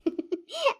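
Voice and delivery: a high, bright burst of laughter. Acted. Son laughs at his tearful mother, high laugh. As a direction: laugh fondly at her fuss.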